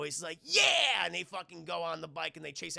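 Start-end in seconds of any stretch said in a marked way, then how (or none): 0.4-1.0 put-on high, loud kid's yell; excited cheer. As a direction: whoop like a thrilled boy. Boy cheering, retold.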